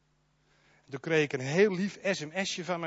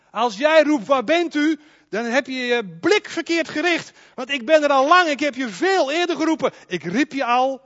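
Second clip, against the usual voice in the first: loud, raised preaching voice; preaching at full force; 5 semitones higher, 6 dB louder than usual. Real, not acted. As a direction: proclaim it to the whole church. Preaches God calls first. (luid en vurig preken)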